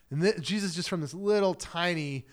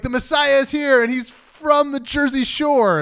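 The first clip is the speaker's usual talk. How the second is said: loud, high-pitched proclamation; hammed-up excited herald; mock excited announcement; 9 semitones higher, 9 dB louder than usual. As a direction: announce absurd news at full voice, stretching the end.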